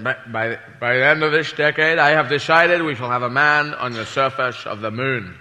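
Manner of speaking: low, measured Kennedy oration; lightly voiced Kennedy moon speech; a hint of Kennedy announcing; Kennedy impression. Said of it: "President Kennedy said".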